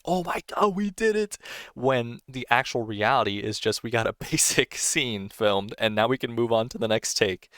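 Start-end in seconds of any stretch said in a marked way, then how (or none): 0.0-1.4 high-pitched imitation of elated screaming; mock celebratory yell. Film crew celebrating a finally finished shot, mock excited yell. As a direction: mimic an overjoyed crew cheering, far higher than usual. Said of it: "They're yelling, they're screaming, like".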